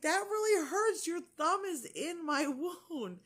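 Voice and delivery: high, exasperated complaint; retold pained protest.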